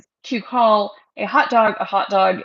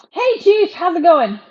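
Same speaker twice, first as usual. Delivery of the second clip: high, bright pet-talk greeting; talking to the dog; 8 semitones higher, 6 dB louder than usual. Real, not acted. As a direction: greet the dog in a raised sing-song voice.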